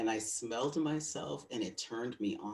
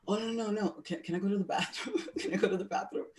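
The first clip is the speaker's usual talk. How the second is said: much higher, fast, giggling plea; mock pleading; 11 semitones higher, 3 dB louder, faster than usual. Speaker begging partner for the bathroom, high laughing plea. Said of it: "And I was like".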